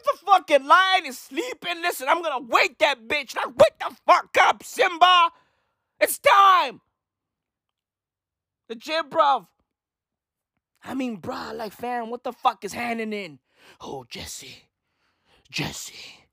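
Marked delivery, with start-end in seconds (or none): 3.6-6.8 higher, hammed-up wake-up call; mock challenge yell. Comedian waking a sleeping lion for a fight, mock yell. 13.8-16.3 very low, quiet imitation of a drug cook; Heisenberg impression. Drug cook calling his partner, hushed low impression. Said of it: "Talking about Breaking Bad".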